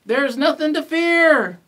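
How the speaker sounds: loud, high reassurance from the pack; calling out. A narrator's reading. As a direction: call it across to a friend. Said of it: "called the Dingoes".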